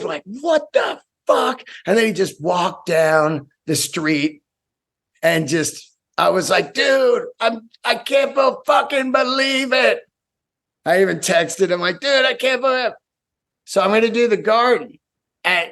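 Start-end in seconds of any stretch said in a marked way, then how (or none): 0.2-1.6 high, drawn-out astonished cry; high-pitched incredulous outburst; stunned disbelief. Retold or imitated. Retold stunned reaction to offer. 6.7-10.0 high-pitched, thrilled disbelief; overjoyed, incredulous high voice; amazed disbelief. Retold or imitated. Retold disbelief at big offer. 12.0-12.9 high, giddy disbelief; excited high-pitched outburst; amazed disbelief. Retold or imitated. Retold excited text to friend.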